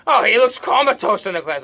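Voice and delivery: high, loud, scornful shout; mother's voice. Retold or imitated. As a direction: scoff at your son like a fed-up mother. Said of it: "my mother saying".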